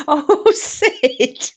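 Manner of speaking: high-pitched through real laughter; laughing. Real, not acted. Reacting to Sid's joke, laughing. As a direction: gasp it out while laughing.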